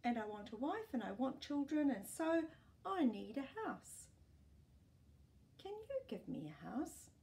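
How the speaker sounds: 男孩急切地要东西; boy. Acted. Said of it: "he said".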